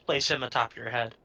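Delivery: ordinary talk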